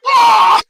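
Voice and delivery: imitated high loud outcry of fans; a sudden high shriek of mock outrage; shrieking like an angry crowd; fans' outraged scream.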